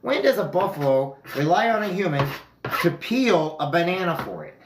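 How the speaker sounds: incredulous. Retold or imitated. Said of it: "I'm like".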